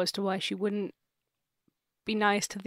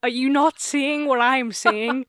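mock exasperated dad; 4 semitones higher, 8 dB louder than usual. Dad confronts his family about the ghost, mock exasperated. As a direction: stretch it out in mock outrage. Dad's exasperation in acted quarrel.

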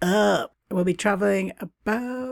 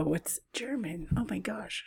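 whispered aside; 10 dB quieter than usual. Real, not acted. Forgets German, aside to self.

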